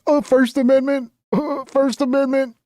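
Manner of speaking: high, whiny mock protester voice. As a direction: sneer it like a smug protester. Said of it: "start screaming at him like I'm insane".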